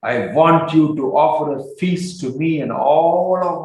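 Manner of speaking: emphatic, stretched-out demand; angry mood. Acted. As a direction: lay down the penalty with mild acted anger. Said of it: "In his angry mood".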